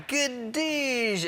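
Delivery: high, drawn-out cartoon crash sound; imitated crash noise. Comic retelling his car crash, mock crash noise. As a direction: voice the smash as a nonsense word.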